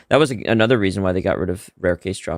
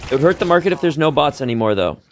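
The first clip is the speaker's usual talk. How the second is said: normal talking voice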